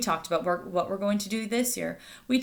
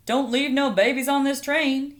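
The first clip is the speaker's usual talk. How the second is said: louder, higher playful conductor call; joking conductor; 6 semitones higher, 5 dB louder than usual. Acted. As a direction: tease the passengers with the old joke. Conductor's joke to passengers.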